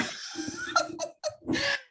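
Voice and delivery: high burst of real laughter; bright high delighted laugh. Real, not acted.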